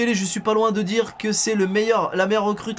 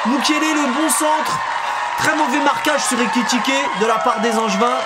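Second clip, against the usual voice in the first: loud, high goal call; excited sports commentary; 7 semitones higher, 7 dB louder than usual. Acted. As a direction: shout the goal like a live commentator.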